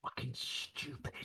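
very quiet, slow grumbling aside; hushed, disgusted muttering; muttered grumble. Real, not acted. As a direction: grumble it under your breath, drawn out. Grumbling aside at renamed city.

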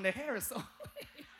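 the wife's line broken by a laugh; laughing repeat. Shown as a demonstration. Preacher repeats his wife's words to the church, laughing. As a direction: laugh your way through the quote. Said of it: "Use that one".

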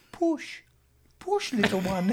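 high, gentle coaxing voice; Jurassic Park scientist. Retold or imitated. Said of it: "that scene in Jurassic Park when he's like".

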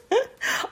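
short high-pitched giggle; a quick giggle at herself. Real, not acted. Laughs at own voice.

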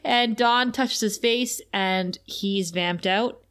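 ordinary delivery

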